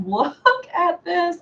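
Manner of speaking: high, stretched-out delight; delighted showing off. Real, not acted. Showing off her new curls, delighted. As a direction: show it off high and drawn out, laughing.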